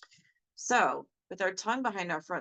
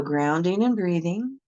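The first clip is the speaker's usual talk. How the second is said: steady, firm meditation guide voice; guided meditation; 5 dB louder than usual. Acted. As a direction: lead them through grounding step by step. Leads a grounding meditation.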